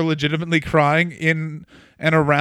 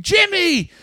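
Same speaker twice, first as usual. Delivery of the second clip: high, drawn-out excited scream; grito imitado; 13 semitones higher, slower than usual. Retold or imitated. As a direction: cry out the name like a thrilled wrestling commentator. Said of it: "starts screaming".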